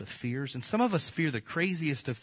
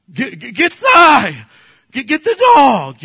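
panicked shout, much higher and far louder; yelling for the dog; 11 semitones higher, 16 dB louder than usual. Retold or imitated. Preacher reliving yelling for his dog at a snake, panicked shout. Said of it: "I'm yelling for my dog".

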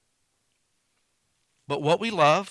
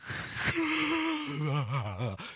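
long, drawn-out fake yawn; exaggerated yawning sigh; mock yawn; 6 dB quieter than usual. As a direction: act out a big yawn mid-task. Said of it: "sorry, I made you yawn".

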